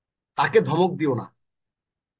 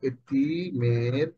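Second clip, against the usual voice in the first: very slow; 5 semitones lower, 4 dB quieter, much slower than usual. Shown as a demonstration. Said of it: "তুমি এতিমের উপর কঠোর হইও না".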